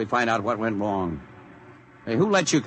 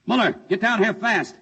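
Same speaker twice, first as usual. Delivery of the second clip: higher, urgent summons; urgent call; 9 semitones higher than usual. Acted. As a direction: call him down now, alarmed. General finds metal below.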